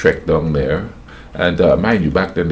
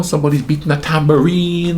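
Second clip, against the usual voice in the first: usual speaking voice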